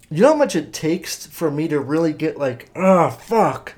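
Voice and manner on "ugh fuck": a touch of angry frustration; gritted teeth angry. Shown as a demonstration. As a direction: grunt it through gritted teeth. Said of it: "really just grit my teeth angry".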